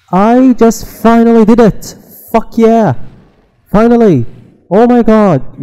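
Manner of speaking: loud, high, elated yell; triumphant shout. Real, not acted. Gamer finally succeeding after many tries, triumphant shouting. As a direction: shout it out in real relief and triumph. Finally beats the game section.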